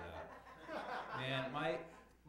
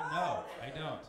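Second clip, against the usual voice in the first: loud, higher-pitched refusal; exasperated refusal; 6 semitones higher, 13 dB louder, slower than usual. Acted. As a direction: blurt it out loudly, exasperated. Refusing second picture offer.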